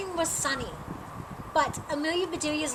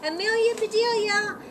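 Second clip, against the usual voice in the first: calling out; 7 semitones higher, 4 dB louder than usual. Acted. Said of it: "her mother called".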